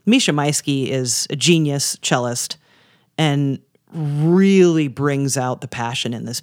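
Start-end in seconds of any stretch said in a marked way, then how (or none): none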